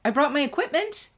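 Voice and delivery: high, bright, eager announcement; chirpy. A narrator's reading. Twilight showing Sunset her stargazing gear, chirpy and high. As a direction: chirp it out with glee. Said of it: "Twilight chirped".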